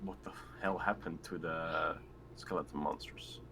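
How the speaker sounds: usual speaking voice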